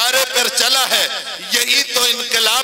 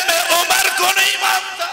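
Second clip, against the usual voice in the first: defiant shout; 8 semitones higher than usual. Acted. Heckler defying the preacher, shouted and high. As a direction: fling the denial back.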